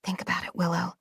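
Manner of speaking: barely audible self-talk, close to a whisper; hushed inner voice. Acted. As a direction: murmur it to yourself like a private thought. Inner voice urging herself.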